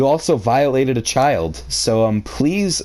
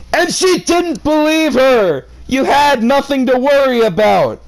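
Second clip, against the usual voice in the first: indignant shout; 13 semitones higher, 4 dB louder than usual. Real, not acted. Reader yelling back at the threatening uncle, high indignant shout. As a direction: shout him down for blaming her.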